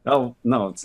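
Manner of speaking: ordinary talk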